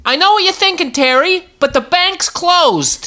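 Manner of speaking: put-on high-pitched old-timey character. Telling Terry no making out, old-timey voice. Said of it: "In an old-timey voice".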